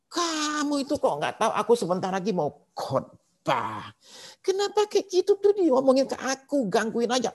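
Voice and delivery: high-pitched hammed-up angry outburst; suami marah. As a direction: imitate a husband exploding at his wife on the phone. (menirukan suami marah-marah, suara melengking tinggi)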